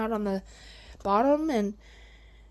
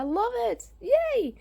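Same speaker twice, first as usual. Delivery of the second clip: delighted yay; 13 semitones higher, slower than usual. Real, not acted. Delighted by her plants.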